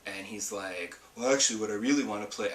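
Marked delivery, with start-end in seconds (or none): 1.2-2.5 quick, mock husband voice; put-on husband voice. Wife mimicking husband's game request, quick put-on voice. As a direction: imitate your husband in a silly put-on voice. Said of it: "I don't know why I'm doing this voice for him".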